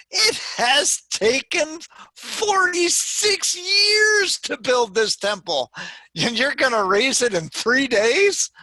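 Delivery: very high, loud, stretched-out disbelief; hammed-up shouted incredulity; incredulous outcry. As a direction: throw the claim back at him, scandalized. Challengers reply in disbelief.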